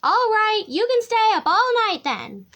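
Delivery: high, loud exasperated roar. A narrator's reading. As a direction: lose your temper as a tiger mother. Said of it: "Mommy Tiger roared".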